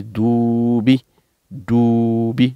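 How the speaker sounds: "Doubi" with a long held "ou"; teacher demonstrating the long vowel in "doubi"; drawn-out dou. Shown as a demonstration. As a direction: stretch the first syllable of "doubi". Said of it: "qui est tiré ici".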